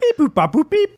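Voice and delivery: high beeping robot noises; toy robot voice. Pretend. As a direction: chirp it like a little toy robot. Robot noises while generating number.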